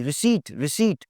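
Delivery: "रिसीट" slowly as ri-SEET; silent p. Shown as a demonstration. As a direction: say "रिसीट" without any p. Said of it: "पी की आवाज़ नहीं आएगी जी".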